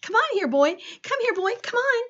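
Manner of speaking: high, coaxing call; calling a pet. A narrator's reading. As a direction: coax the runaway mouse over. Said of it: "Stanley yelled".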